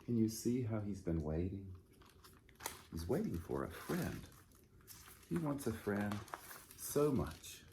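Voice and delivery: usual speaking voice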